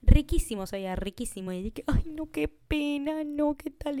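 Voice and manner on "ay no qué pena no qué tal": high, slow mock sympathy; mock polite dismay. Retelling a friend's lunch, mock polite dismay. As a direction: play it up as fake pity.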